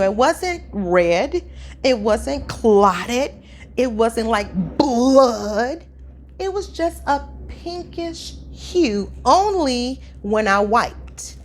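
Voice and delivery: slow emphatic. Real, not acted. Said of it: "emphatic slow listing".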